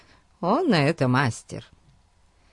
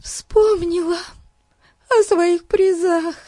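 put-on high, tearful young girl; 4 dB louder, slower than usual. A narrator's reading. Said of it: "пояснила она между всхлипываниями".

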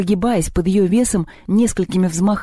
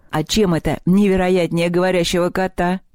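usual speaking voice

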